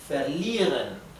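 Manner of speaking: "Verlieren" said slowly with a long i; slow, careful "Verlieren" with a long i sound. Shown as a demonstration. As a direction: stretch the ie in "Verlieren". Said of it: "La I con la E se pronuncia I".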